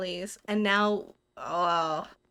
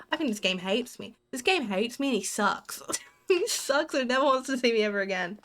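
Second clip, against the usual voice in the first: ordinary talk, nothing marked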